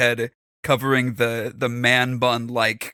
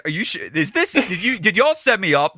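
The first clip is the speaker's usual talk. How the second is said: ordinary delivery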